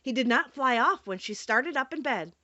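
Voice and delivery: ordinary delivery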